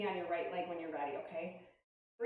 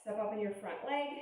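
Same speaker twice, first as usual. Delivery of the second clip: ordinary talk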